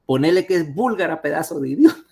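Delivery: mock the junior doctor with contempt; scornful professor. Old professor scolding the resident about the diagnosis, scornful. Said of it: "me dice la, el viejo".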